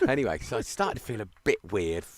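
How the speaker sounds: usual speaking voice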